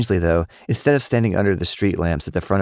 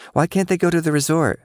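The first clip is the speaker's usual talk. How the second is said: put-on high, eager little girl; chirpy kid asking a grown-up; girlish voice; 11 semitones higher than usual. A narrator's reading. Cicely asking Lily's mom, high and girlish.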